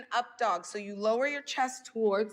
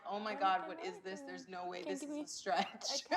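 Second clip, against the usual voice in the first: beginner's reaction; 3 semitones lower, 8 dB quieter than usual. Retold or imitated. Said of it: "feel like".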